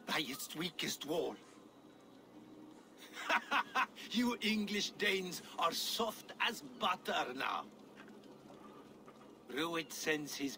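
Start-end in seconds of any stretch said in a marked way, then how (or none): 3.0-4.1 a high, airless, scornful laugh; mocking laugh. Bound scout laughing at the English Danes, a high scornful laugh. Captive scout mocks his captors. 4.1-7.6 higher, laughing through the words; laughing taunt. Acted. Captive scout taunting his captors, laughing through the insult.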